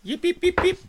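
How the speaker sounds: high, yappy little dog yips; yappy chihuahua voice. Pretend. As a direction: yip like a tiny excited dog. Toast, playful yipping after cheers.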